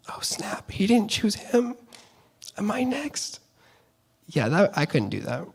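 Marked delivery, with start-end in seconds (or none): 0.1-3.4 hushed, anxious whisper to himself; breathy worried aside, half to yourself; nervous whisper. Pretend. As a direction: whisper it like a brother afraid he's next. Imagined nervous brother inside thoughts.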